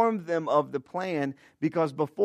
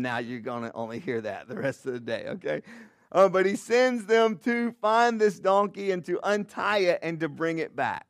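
ordinary delivery

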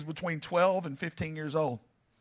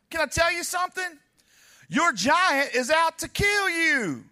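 preaching; 8 semitones higher than usual. Acted. Preacher warns the congregation their giant wants to destroy them, full-force preaching. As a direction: drive it home to the congregation.

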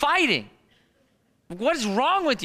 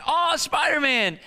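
put-on excited kid; 8 semitones higher than usual. Kids excited about new toy.

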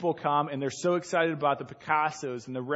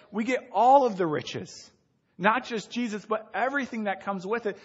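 loud, high fervent preaching; 5 semitones higher, 3 dB louder than usual. Acted. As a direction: drive the point home with fervor. Preaching climax, raised voice.

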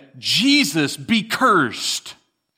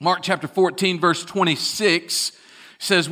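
usual speaking voice

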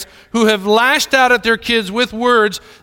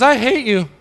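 higher, a hint of small child; lightly voiced little girl; 5 semitones higher than usual. Daughter repeats it to stunned dad, lightly childlike. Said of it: "she was about three or four years old".

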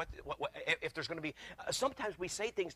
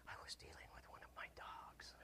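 fully whispered aside; 20 dB quieter than usual. Shown as a demonstration. Repeating his story to the congregation, whispered. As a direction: drop it to a whisper.

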